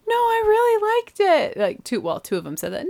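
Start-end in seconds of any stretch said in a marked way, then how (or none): 0.1-1.6 put-on high squeaky kid voice, polite and unconvincing; mock kid voice. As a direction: play a child politely insisting. Kids' polite answer imitated.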